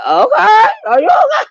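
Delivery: high laughing shout of disbelief; mock concern, laughing. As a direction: yell it while cracking up. Mocking her upset with laughter.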